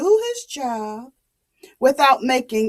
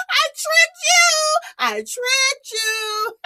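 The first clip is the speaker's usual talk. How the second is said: put-on gloating boy. Naughty boy taunting the villagers, high and gleeful.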